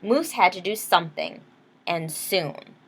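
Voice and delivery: ordinary delivery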